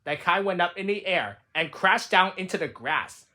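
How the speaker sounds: usual speaking voice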